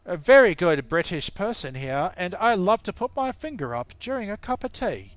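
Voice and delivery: a hint of posh English gentleman bragging about tea; posh British accent. Australian caster mocking the English to his co-caster, light posh British accent. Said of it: "I'll get my Pommy voice on".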